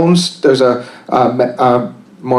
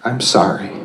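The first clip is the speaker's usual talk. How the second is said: calm, unhurried guided meditation voice; 6 semitones lower, 4 dB quieter than usual. Acted. Leading the room through a calming meditation, softly. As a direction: guide them gently into stillness.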